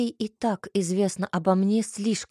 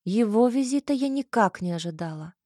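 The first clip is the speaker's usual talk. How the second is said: ordinary delivery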